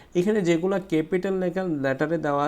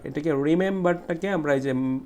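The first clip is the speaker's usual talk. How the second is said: ordinary talk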